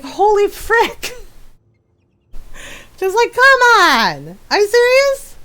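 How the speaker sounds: shocked, rising disbelief; high incredulous. Real, not acted. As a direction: pitch jumps up in disbelief. Exasperated, higher.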